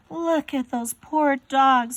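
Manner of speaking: plain reading voice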